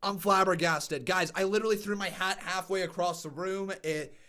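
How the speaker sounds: stunned and quiet. Real, not acted. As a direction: let it sink in, deflated.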